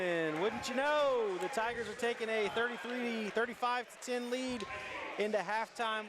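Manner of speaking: thrilled buzzer beater call; excited. Real, not acted.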